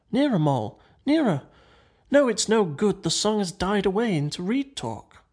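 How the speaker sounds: mildly agitated storytelling voice; urgent. A narrator's reading. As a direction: urge insistently, then concede. Rat urges, then gives up straining.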